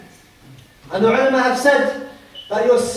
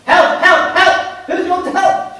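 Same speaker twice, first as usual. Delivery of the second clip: raised, higher pleading calls for help; desperate cry for help; 5 semitones higher, slower than usual. Acted. Preacher voicing the damned in hell crying for help, desperate. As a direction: cry out like someone begging to be saved.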